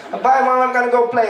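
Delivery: put-on high sing-song kid saying bye; child voice. Kid calling bye to his mom, high and cheerful. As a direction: chirp it like a happy little kid.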